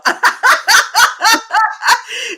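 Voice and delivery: loud, high belly laugh; burst of laughter. Real, not acted. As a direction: crack up loudly at the surprise.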